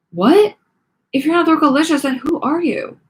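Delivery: confused. A narrator's reading. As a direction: demand an answer.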